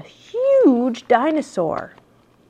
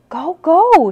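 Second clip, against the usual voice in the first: urging; 7 semitones higher, 7 dB louder than usual. A narrator's reading. Sister urging him on.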